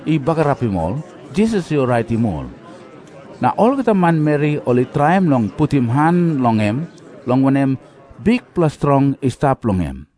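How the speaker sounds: plain reading voice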